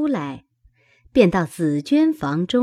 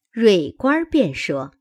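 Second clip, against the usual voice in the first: plain reading voice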